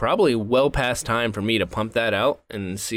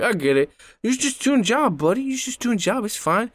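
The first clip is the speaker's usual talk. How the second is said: much higher, silly broken-English voice; mock dumb voice; 11 semitones higher than usual. Drunk guy mocking a teen worker in a silly high voice. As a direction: play a drunk patronizing a worker. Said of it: "And I was like".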